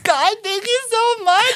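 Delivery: high, slow, tearful thanks; crying thanks. Retold or imitated. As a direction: thank God through sobs. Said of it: "then I cried some more".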